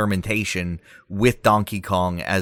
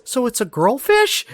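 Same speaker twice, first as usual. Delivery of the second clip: much higher, squeaky sidekick; Diddy voice. Pretend. Diddy asking Donkey Kong a dumb question, high squeaky voice. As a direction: play the naive little sidekick. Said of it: "And Diddy goes".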